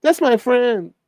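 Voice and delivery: high, loud, incredulous wait a minute; mock shock. Retelling reaction to a chatter acting like a kid, high mock shock. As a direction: act floored at the childish outburst. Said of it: "And I'm like".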